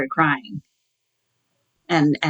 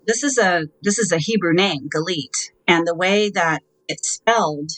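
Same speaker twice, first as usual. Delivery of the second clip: usual speaking voice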